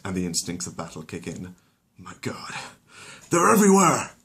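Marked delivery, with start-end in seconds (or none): 2.0-4.1 calm breaks into panic; panicked scream. Acted. Flaherty seeing the fleas, panicked and loud. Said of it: "His calm breaks".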